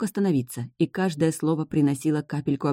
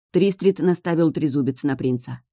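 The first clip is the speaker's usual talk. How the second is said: plain reading voice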